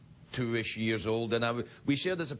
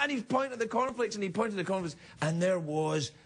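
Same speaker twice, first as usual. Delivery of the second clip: normal talking voice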